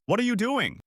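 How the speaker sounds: high-pitched, restrained yell of reproach; mild yell. A narrator's reading. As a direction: demand an answer, pitch jumping up. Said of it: "The manager yells".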